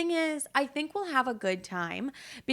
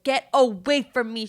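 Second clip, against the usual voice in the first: mock snapping mom; 3 semitones higher, 7 dB louder than usual. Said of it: "She's like".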